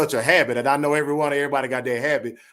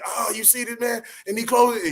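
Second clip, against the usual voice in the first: sudden high-pitched hype call, imitating Reggie; playful impression of a hyped commentator; excited fight commentator; 11 semitones higher than usual. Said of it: "it's like the homeboy, like".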